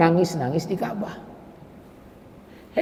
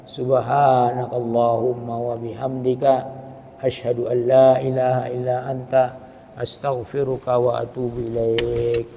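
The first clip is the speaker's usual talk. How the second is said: closing prayer; 8 semitones lower, 6 dB quieter than usual. Acted.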